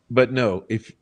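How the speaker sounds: normal talking voice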